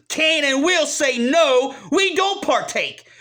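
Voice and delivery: angry refusal. Real, not acted. Ranting that men can say no, angry defiance.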